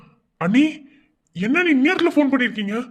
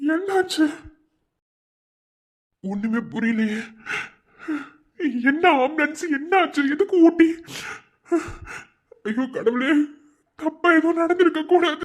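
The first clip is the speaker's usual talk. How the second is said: higher, rising anxious questions; panicked worry; 5 semitones higher than usual. Acted. Brother-in-law panics after the frightening call. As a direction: fear cracking into the questions.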